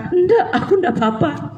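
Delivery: high, coy denial with a laugh; girl pretending fine. Crying girl brushes off a friend's question, coy and high. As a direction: play the sulky girl insisting she's fine. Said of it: "cewek-cewek kalau lagi nangis".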